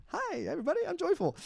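very high, sugary put-on greeting; fake church cheer. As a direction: chirp it like a forced smile at church. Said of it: "nope, I'm at church".

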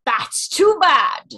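slow, loud, commanding lion line; slow booming lion king voice; booming Aslan quote. Retold or imitated. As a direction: roar it out slowly like a great lion. Voice quote impressions game.

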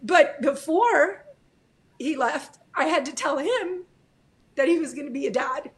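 high voice, halting with emotion; teary, with pauses to hold together; real tearfulness. Real, not acted. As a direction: get through it while crying. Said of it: "I'm crying".

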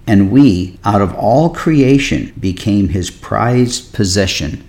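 deliberate scripture reading, phrase by phrase; slow reading. Real, not acted. Pastor wants listeners to receive the word, slow reading. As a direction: read slowly, with room to let it soak in. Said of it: "I'm gonna read a little bit slow".

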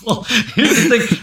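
usual speaking voice

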